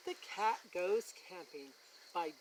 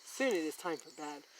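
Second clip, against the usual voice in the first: usual speaking voice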